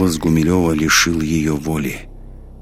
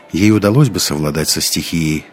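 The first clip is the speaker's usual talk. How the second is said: ordinary delivery, nothing marked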